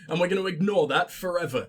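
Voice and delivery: normal talking voice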